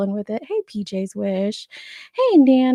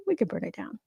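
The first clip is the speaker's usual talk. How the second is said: much softer, sly and hushed; quiet mischievous; 10 dB quieter, faster than usual. Real, not acted. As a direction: murmur it like a secret plan. Soft mischievous repeat.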